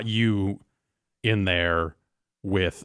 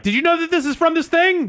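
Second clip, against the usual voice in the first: high, eager internet commenter; squeaky eager fan; 6 dB louder than usual. Retold or imitated. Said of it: "people jumping in to try to tell".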